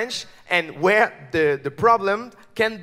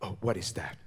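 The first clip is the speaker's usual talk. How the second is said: very low, hushed superhero voice; Batman; 11 semitones lower, 11 dB quieter than usual. Acted. Superhero baffled by a bomb of wine and cheese, deep hushed voice. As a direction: keep it deep, soft and deadly serious. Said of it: "and he said".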